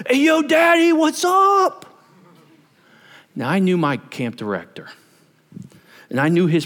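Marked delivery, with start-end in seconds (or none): 0.1-1.9 mock hyped-up kid hollering a greeting to God; over-casual youth leader praying. Young leader opens camp prayer like greeting a buddy, mock hyped holler. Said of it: "he goes".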